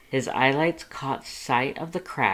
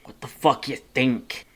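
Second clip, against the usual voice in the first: snarl; 2 semitones higher, 4 dB louder than usual. A narrator's reading. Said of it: "Red snarled".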